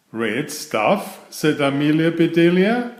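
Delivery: lively read-aloud for children, voicing each character; story narration. A narrator's reading. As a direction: read it like a bedtime picture book. Reads picture book aloud.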